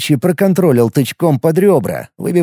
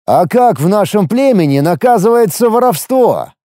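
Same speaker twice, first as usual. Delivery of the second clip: higher, ominous, menacing question; sinister tone; 7 semitones higher, 3 dB louder than usual. A narrator's reading. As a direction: turn the tone sinister. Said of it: "сменил я тон на зловещий".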